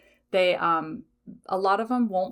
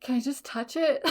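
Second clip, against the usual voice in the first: small, higher, longing plea; pleading; 6 semitones higher than usual. Retold or imitated. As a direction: beg sweetly to touch it. Pleading to touch the gem.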